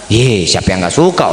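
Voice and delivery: very low, held-down pitch; low-voiced retort. Speaker answers friend asking if he likes the car, low-voiced retort. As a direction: say "siapa yang nggak suka" down low and level.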